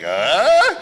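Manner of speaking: loud rising "uh?"; mock expectant group noise, shooting up; high, loud questioning grunt. Room reacts to race car reveal.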